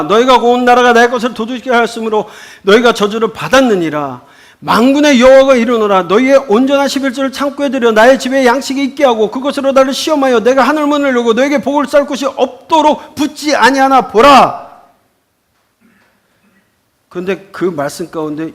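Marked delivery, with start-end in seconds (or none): 0.0-10.4 high, loud, forceful verse reading. Acted. Reads scripture aloud in sermon. 10.4-14.8 loud, high proclamation of the verse. Acted.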